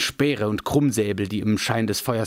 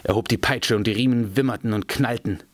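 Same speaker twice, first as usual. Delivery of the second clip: ordinary delivery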